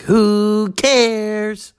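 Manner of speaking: high, loud Elaine Stritch bark; channeling Elaine Stritch. Retold or imitated. Channeling Elaine Stritch's movie line to listeners, high and loud. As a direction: belt who cares like Stritch. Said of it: "you were like".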